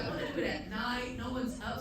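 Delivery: usual speaking voice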